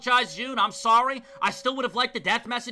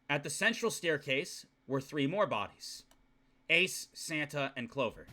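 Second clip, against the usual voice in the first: low, soft narration read from the novel; story narration; 8 semitones lower, 6 dB quieter than usual. Acted. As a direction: read the bleak scene quietly and low.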